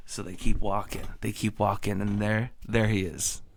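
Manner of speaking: ordinary talk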